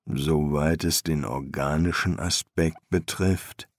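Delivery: low, a little muffled; faintly muffled master's voice; slightly muffled. A narrator's reading. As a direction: answer low, voice slightly dulled. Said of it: "Seine Stimme klang ein wenig dumpf".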